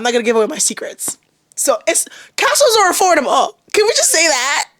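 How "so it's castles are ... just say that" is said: high and loud, gleeful; excited high claim. Real, not acted. Telling viewers castles are cheap, excited. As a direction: announce it like a thrilling secret.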